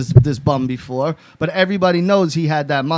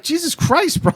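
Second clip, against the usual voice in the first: high-pitched, laughing disbelief; aghast reaction; 12 semitones higher than usual. Retold or imitated. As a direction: squeal it in mock shock. Aghast reply to friend in story.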